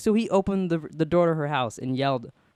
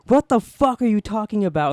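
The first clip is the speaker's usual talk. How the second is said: higher, louder outraged mock scream; retold scream; 7 semitones higher, 6 dB louder than usual. Retelling cousin's scream at boyfriend, mock scream. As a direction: act out her angry outburst, raised pitch and volume. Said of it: "I hear her scream".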